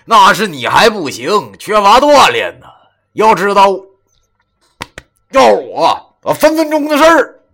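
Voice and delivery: laughing brag, high and loud; proud boasting. A narrator's reading. Friend teases him over the stone lion task, proud boasting. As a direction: crow about how easy it would be. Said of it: "用一种很自豪的语气".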